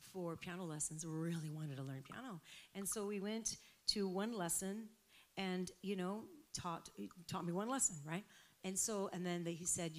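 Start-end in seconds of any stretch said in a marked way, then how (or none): none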